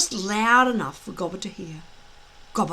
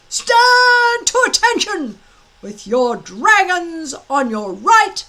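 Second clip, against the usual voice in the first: loud, high drill command; yelled order; 9 semitones higher, 9 dB louder, slower than usual. Acted. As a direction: bark the order down the line. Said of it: "yelled Gobber the Belch".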